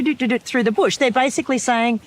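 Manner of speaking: ordinary delivery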